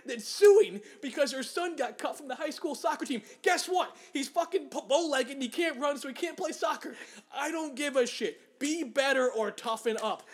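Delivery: real anger with laughter breaking in; pissed off. Real, not acted. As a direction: rail at the suing mom, voice up and pushing. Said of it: "He is so pissed off right now".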